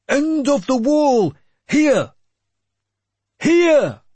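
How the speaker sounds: very high, loud calls; battlefield shouting. A narrator's reading. Warrior marking the end of the wall, shouting. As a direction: shout out to mark the line.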